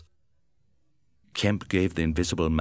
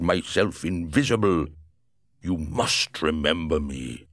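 Griffin's voice. Acted. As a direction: press an old friend to remember. Griffin pleads with Kemp.

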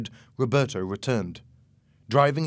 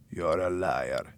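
low, slow character voice; menacing accuser; 5 semitones lower, 3 dB quieter, slower than usual. A narrator's reading. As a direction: accuse slowly, pitched down. Wounded Jose accuses Louis from behind.

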